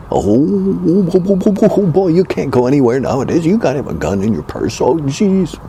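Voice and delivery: high, fast, fretting oh-oh-ohs; mock panic. Mocking a panicky gun owner in a high fretting voice. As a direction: flap about like a frightened purse-carrier.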